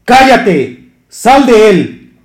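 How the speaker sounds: loud, slow, commanding rebuke; stern rebuke. Acted. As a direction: cast the spirit out with full force. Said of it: "Yeshua le reprendió".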